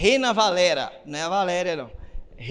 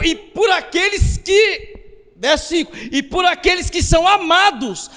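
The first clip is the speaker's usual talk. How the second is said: high, forceful pulpit proclamation; fervent preacher, key words drawn out and shouted; full-force preaching; 9 semitones higher, 5 dB louder than usual. Real, not acted. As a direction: proclaim it to the whole church, pitch raised throughout.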